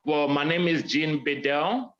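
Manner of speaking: normal talking voice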